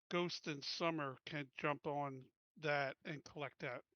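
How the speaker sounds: ordinary talk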